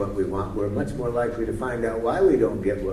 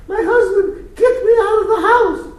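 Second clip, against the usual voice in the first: much higher, louder, half-laughing panic; a hammed-up pregnant woman at the door; imitating a frantic woman; 13 dB louder than usual. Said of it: "she's crying her heart out".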